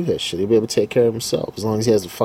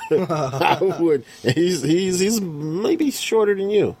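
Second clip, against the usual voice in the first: laughing; 8 semitones higher, slower than usual. Real, not acted. Laughing about the fighter.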